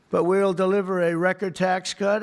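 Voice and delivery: ordinary delivery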